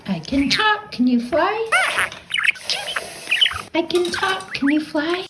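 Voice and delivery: high, sing-song lesson voice; teaching the parakeet. Shown as a demonstration. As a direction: coax the bird with a high, sing-song repeat. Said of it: "the classic".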